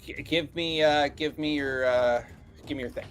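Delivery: ordinary talk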